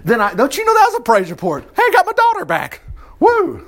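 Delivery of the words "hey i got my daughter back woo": much higher, cheering; delighted high mock celebration; overjoyed parent. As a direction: whoop it out like a thrilled dad.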